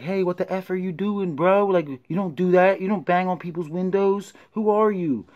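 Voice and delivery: high-pitched, heated retelling of yelling at the cop; retold angry yell. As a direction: snap back high and hot, reliving the anger. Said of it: "I got upset".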